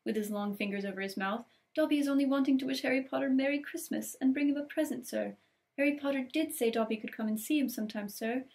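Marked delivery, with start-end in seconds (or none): 1.8-8.4 high, eager elf voice; house-elf voice. Acted. Elf wishing Harry Merry Christmas, high eager voice. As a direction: pipe up like an earnest little house-elf.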